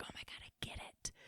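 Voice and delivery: hushed, awestruck whisper; barely voiced, overcome with feeling; whispered epiphany. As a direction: whisper it like a sudden revelation. Stricken by art, retold epiphany.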